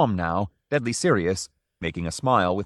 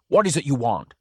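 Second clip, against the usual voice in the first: higher, firmer owner's voice; sterner repeat; 4 semitones higher than usual. A narrator's reading. Owner repeats his demand to Drioli, sterner. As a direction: press the question harder.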